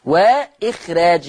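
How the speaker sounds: وإخراج slowly with a thin r and fronted long aa, ikh-reej; slow demo of وإخراج keeping the r light; light raa. Shown as a demonstration. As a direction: keep the r of وإخراج thin, not heavy. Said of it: "الراء بتاعتها مرققة".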